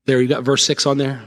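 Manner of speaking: ordinary delivery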